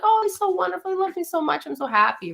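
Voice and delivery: high, dreamy gushing over him; gushing new love. Retold or imitated. Newly in love wife gushing about her husband, high and dreamy. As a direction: swoon like a woman madly in love. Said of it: "You were like".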